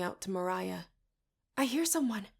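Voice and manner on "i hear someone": usual speaking voice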